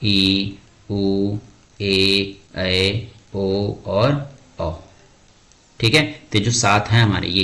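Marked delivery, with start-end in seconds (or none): 2.5-4.7 ऐ, ओ and औ each held long and slow; slow, held dirgha vowels; long vowels drawn out. Shown as a demonstration. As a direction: stretch each long vowel to show its length. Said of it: "वही हमारे क्या कहलाते हैं".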